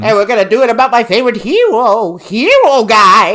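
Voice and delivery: loud, high mock baby-talk puppet; hammed-up babyish lisp, r's turned to w's. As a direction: imitate an annoying puppet belting out baby talk. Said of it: "like, that baby talk".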